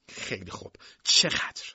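breathless whisper, no voice. Acted. Beaten sister whispers her surrender to brother. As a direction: give in with a hushed whisper. Sister gives in, asks price.

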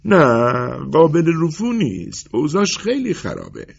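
high, emphatic tailor's verdict; dismissive tailor. Acted. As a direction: turn the job down firmly, pitched high.

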